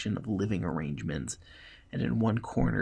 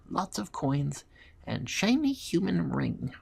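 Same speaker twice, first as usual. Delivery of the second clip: high squeaky kobold reading aloud; squeaky voice, loud and proud at the start; 7 semitones higher, 4 dB louder than usual. Acted. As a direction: announce it proudly in a little creature's high voice. Kobold proudly reads the list.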